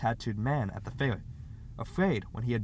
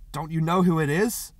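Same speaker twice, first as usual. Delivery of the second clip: mildly anguished cry, raised and loud; a touch of anguish, louder and higher; 5 semitones higher, 6 dB louder than usual. A narrator's reading. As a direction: cry out, desperate to be understood. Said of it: "he cried in anguish".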